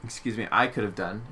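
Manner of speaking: ordinary talk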